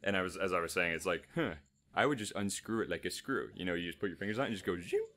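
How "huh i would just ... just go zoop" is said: normal talking voice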